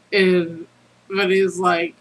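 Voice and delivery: ordinary delivery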